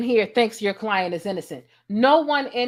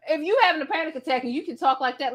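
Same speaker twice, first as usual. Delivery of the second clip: ordinary delivery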